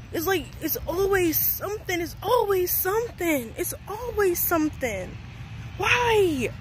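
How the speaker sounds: high, wailing lament; exasperated. Real, not acted. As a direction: plead upward in mounting frustration, falling off each time. Exasperated venting, repeated lament.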